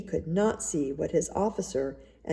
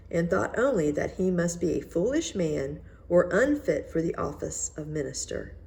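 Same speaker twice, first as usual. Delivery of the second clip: usual speaking voice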